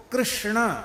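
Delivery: "कृष्ण" with full kri-sh-na cluster; deliberate Sanskrit "कृष्ण"; correct pronunciation. Shown as a demonstration. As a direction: articulate "कृष्ण" carefully, retroflex sh and n. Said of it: "ये शुद्ध उच्चारण".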